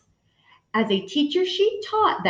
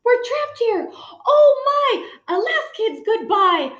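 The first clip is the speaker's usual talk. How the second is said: much higher, hammed-up wail; panicked teacher; 11 semitones higher than usual. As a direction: melodramatic cry of doom. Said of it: "started to scream".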